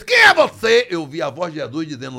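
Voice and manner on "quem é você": much higher and louder challenge; threatening challenge. Retold or imitated. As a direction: confront the intruder. Homem grande confronta, alto. (homem grande confrontando o oficial, em tom alto e ameaçador)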